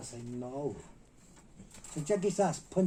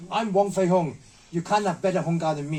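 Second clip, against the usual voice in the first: loud, higher-pitched mimicked boast; retold boastful outburst; 4 semitones higher, 10 dB louder than usual. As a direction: imitate a master pulling rank, loudly. Retold boast in kung fu quarrel.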